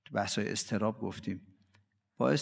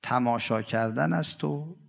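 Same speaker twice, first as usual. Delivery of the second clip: slow, drawn-out devotional verse; poem recitation; 4 dB louder, slower than usual. Acted. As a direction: chant each line slowly like a lament.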